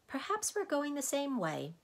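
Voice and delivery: put-on high-pitched boy's voice; hopeful boy. A narrator's reading. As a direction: suggest it hopefully as a child. Boy Milo makes hopeful suggestion.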